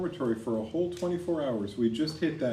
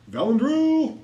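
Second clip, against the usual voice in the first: high, loud, drawn-out name call; 13 semitones higher, 7 dB louder, slower than usual. Real, not acted. Game master summoning the next player, calling out.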